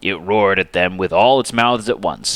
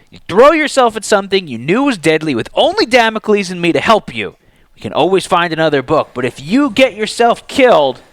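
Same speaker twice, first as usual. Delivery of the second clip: heated, raised arguing voice; angry; 9 semitones higher, 4 dB louder than usual. A narrator's reading. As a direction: scold her for the risk. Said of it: "Zeke sounded every bit as angry as Justice".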